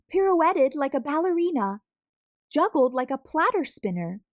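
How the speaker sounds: excited storytelling. A narrator's reading. Narrator excitedly telling how the food tower crashes down.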